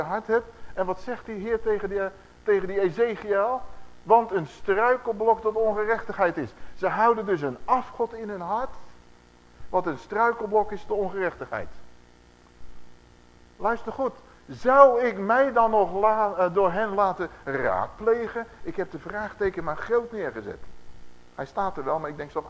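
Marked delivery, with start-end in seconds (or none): 4.0-6.5 scripture reading. Acted. Scripture quote again. 14.5-18.5 slow, loud, heavy question from God; booming divine question. Acted. Preaching God's question.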